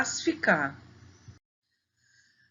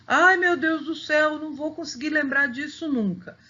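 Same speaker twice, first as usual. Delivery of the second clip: higher, hurried playful wail; mock despair, pitched up and rushing at the end; mock student panic; 6 semitones higher than usual. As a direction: act out an overwhelmed pupil's complaint. Voices a student panicking over the terms.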